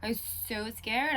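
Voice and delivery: normal talking voice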